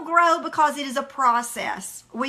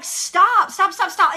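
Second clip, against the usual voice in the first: retold yelling; 5 semitones higher, 6 dB louder than usual. Retold yelling during painful stretch.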